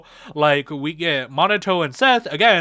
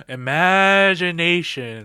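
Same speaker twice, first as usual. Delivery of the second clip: Imagination said slowly, stretched out; slow drawn-out repeat; slower than usual. Shown as a demonstration. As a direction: linger on Imagination as a knowing echo. Repeats word slowly for effect.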